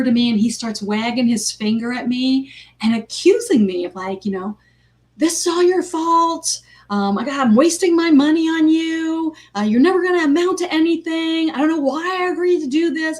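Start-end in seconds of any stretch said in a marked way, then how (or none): 5.2-13.2 put-on higher, louder angry scolding of a child; dad yelling at child. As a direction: wag a finger and tear into the kid. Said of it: "And he's screaming at me".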